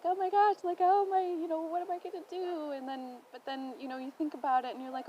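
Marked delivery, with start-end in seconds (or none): 0.0-2.7 1 panicked inner voice. Retold or imitated. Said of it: "you're in the middle of the ocean and you're like".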